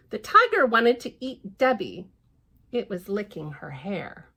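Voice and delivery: ordinary delivery, nothing marked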